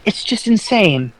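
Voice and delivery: high-pitched, exasperated disbelief; incredulous. Real, not acted. Disbelief at the political news.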